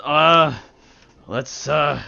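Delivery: a weary monk complaining; gruff sober monk. Acted.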